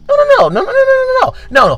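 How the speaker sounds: rapid high-pitched string of refusals; emphatic denial. Real, not acted. Comic correcting who's laughing, high-pitched denial. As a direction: rattle it off high, brushing the idea away.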